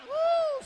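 high, loud joyful whoop; sudden high preacher's whoop; ecstatic whoop. Real, not acted. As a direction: let out a shout of praise.

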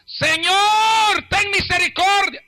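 very high, loud cry for mercy; desperate full-voiced call; pleading shout. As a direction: shout it out, stretching the words. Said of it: "el otro grito que ella pega".

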